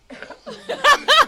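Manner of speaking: loud shrill laughter; high shrieking laugh. Real, not acted. Laugh burst.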